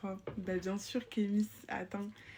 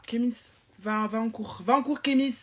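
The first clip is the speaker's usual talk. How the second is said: shouting at Kémis; 2 semitones higher, 7 dB louder than usual. Acted. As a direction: yell him off to class. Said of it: "lui aussi, je lui crie dessus".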